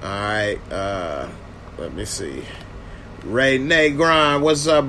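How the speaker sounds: normal talking voice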